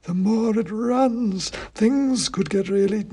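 high, slow, dusty oracle voice; dusty faint ancient head. A narrator's reading. Ancient king's head answering the wizard, slow high dusty chant. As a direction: intone it like a faint, dusty myth.

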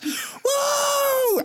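high falsetto whoop; party whoop. Pretend. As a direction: let out a wild party cheer. Richard partying in Bangkok.

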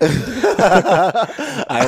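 real amused laughter in the voice; laughing reply. Real, not acted. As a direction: answer while cracking up. Laughing at the silly question.

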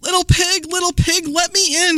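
high mock growl from a storybook wolf; a hint of hungrier wolf, pitched high; lightly growled wolf. A narrator's reading. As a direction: call out high, as a playful wolf. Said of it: "he growled".

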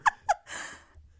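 short, high, loud burst of laughter. Real, not acted. Amused at co-host's gloomy reaction, high loud laugh.

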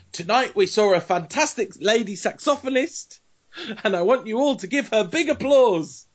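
high, emphatic impression of a manager on stage; imitating a manager grandly calling for applause; Louis van Gaal. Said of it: "I can't do the accent".

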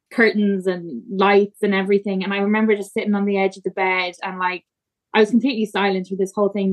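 normal talking voice

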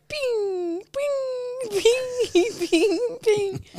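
mock arcade chime, high and soft; game sound effect. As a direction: imitate a game's pinging bell. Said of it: "those games where it will go".

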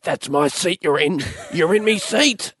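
high, slow broad Aussie complaint. Retold or imitated. Aussie passenger arguing over the seat, broad Aussie whine. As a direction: drawl it like an angry Aussie.

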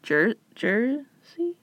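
slow unsure whisper; tentative whispered Jersey. Real, not acted. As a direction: barely voice the guess.

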